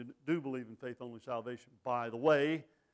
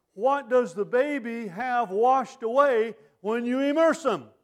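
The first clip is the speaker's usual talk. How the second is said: loud, higher, drawn-out pulpit cadence; full-force preaching; 7 semitones higher, 9 dB louder than usual. Acted. Preacher arguing against infant baptism, preaching at full force. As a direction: preach it with fire to the pews.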